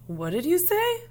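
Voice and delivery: high, startled disbelief; surprised question. Acted. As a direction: pitch it up in shock. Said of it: "Selma asked".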